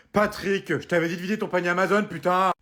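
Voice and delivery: angry calling out. Acted. Wife scolding Patrick over his Amazon basket, angry.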